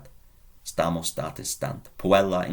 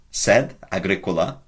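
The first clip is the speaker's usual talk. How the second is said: usual speaking voice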